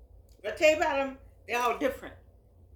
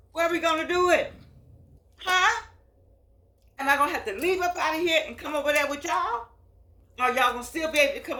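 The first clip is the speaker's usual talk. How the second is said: excited call-out; 5 semitones higher, 5 dB louder than usual. Real, not acted. Rousing her senior viewers.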